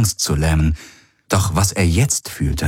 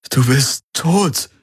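fassungslos; 7 semitones higher, slower than usual. A narrator's reading. Garland sieht toten Freund.

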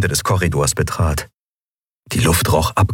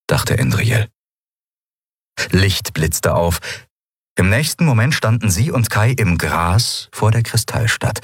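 usual speaking voice

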